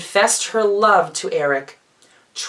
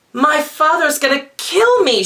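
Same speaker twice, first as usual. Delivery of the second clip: high, loud panicked cry; 9 semitones higher, 5 dB louder than usual. Acted. Ariel realising she missed the concert, crying out in panic. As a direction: blurt it out in alarm, much higher. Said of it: "she cried".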